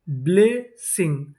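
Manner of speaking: Blessing slowly split as ब्ले, Singh; syllable by syllable. Shown as a demonstration. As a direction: sound out blessing in two parts. Teacher sounding out new word.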